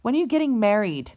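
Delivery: mock-angry mother demanding marriage; mother's angry nagging. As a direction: snap it at her like a scolding mother. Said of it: "the best she can say to me is".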